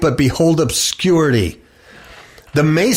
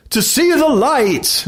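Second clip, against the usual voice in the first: high, slow, mock-reverent reply; ritual answer; 11 semitones higher, slower than usual. Candidate answers the lodge master, high and slow.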